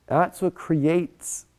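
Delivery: ordinary delivery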